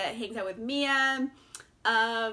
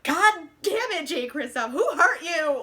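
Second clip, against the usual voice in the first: higher, louder cry of outrage; mock exasperated outburst; 4 semitones higher, 6 dB louder than usual. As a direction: curse the author in comic disbelief, breaking into a laugh. Exclaims at author in retold reaction.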